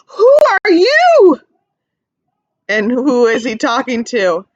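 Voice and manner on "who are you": put-on much higher, louder and slow baby voice; teacher voicing Joey meeting a bee, high and slow; curious little joey. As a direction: ask it wide-eyed like a tiny kangaroo. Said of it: "He says".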